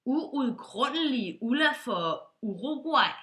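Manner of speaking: slow, exaggerated u sounds in uudgrundelig Ulla for Uruguay; u-words demo. Shown as a demonstration. Johnson testing the forbidden u words, slowly. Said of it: "hvis man skal sige".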